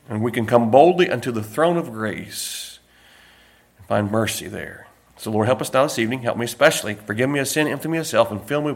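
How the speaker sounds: soft, low opening prayer. Acted. Pastor prays before the sermon, soft and low. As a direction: pray quietly to the Lord.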